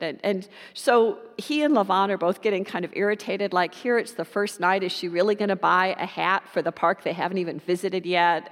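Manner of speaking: normal talking voice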